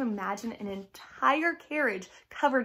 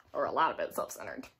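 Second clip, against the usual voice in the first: ordinary delivery, nothing marked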